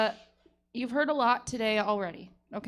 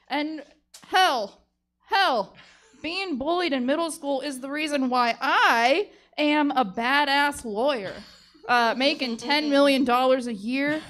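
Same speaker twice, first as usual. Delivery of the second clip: louder and louder, peaking in a yelled "Oh, no"; fired-up closing rant; 8 dB louder than usual. Acted. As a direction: preach it to the jury until it slips out. Lawyer's closing speech boils over.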